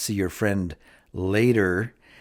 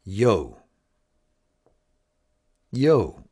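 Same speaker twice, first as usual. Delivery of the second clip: casual Yo. Shown as a demonstration. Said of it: "another way of saying hi".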